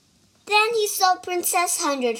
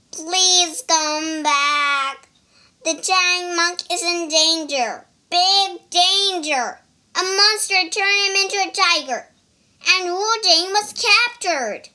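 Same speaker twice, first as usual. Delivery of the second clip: crying pig voice; 6 semitones higher, 5 dB louder than usual. Acted. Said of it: "The pig began to cry".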